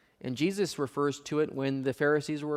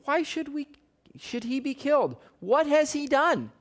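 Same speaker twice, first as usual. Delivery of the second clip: much higher, strained protest; pleading son; 12 semitones higher than usual. Acted. Jonathan pleading with his father Saul, much higher and disbelieving. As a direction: cry out in disbelief at your father.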